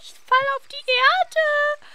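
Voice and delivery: high, put-on voice for the moon. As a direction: play the falling moon. Voicing the falling moon. (hohe, gespielte Mond-Stimme)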